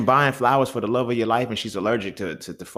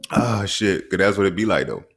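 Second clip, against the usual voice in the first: ordinary talk, nothing marked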